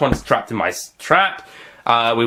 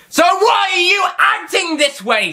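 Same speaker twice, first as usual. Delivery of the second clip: shouting villain; 4 dB louder than usual. Acted. Master shouting at Doctor.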